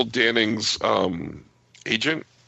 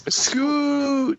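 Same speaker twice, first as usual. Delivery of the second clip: excited greeting. Real, not acted. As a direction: call out his name with joy. Greeting a friend arriving.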